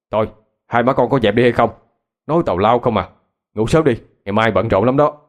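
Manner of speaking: a father's mild telling-off; mildly scolding. A narrator's reading. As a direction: scold them to stop, without shouting. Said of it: "Ba tôi la lên". (giọng ba la rầy nhẹ)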